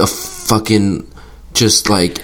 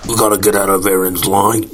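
character voice. Pretend. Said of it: "I thought that was a great voice".

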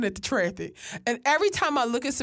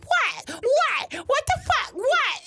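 retold angry yelling; 12 semitones higher, slower than usual. Friend jumps out of the car, mock yelling at the lady. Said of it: "and Sabrina like".

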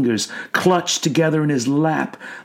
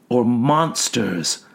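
plain reading voice

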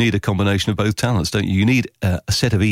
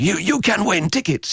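higher, lightly hammed-up promo line; mock promo voice; 11 semitones higher than usual. Host mimicking a tired station promo, hammed-up. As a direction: voice it like a cheesy on-air promo. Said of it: "I talk about tickets sometimes".

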